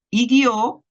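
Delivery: "guió" with a high, stressed final "ó"; stressed ending. Shown as a demonstration. Student setting guío against guió, stress on the end. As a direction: lift the last syllable of "guió" up high. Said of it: "la pronunciación es parecida".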